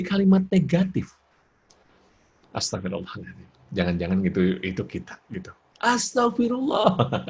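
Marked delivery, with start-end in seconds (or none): 2.5-3.5 soft, low murmured prayer; plain istighfar. Shown as a demonstration. Parent quietly asking forgiveness, soft low istighfar. 5.8-7.2 high, slow, drawn-out mock exclamation; exasperated istighfar. Parent fed up with child, mock high exasperated istighfar.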